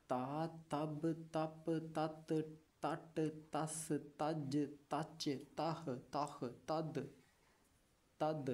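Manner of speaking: slow, even recitation of each sound; teacher chanting the alphabet sounds; syllable drill. Shown as a demonstration. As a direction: sound out every syllable clearly for pupils. Teacher drills Urdu syllables aloud.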